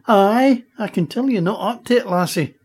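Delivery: put-on Scottish accent. Duncan the egg chieftain speaks.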